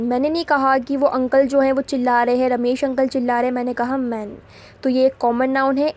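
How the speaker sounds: ordinary talk, nothing marked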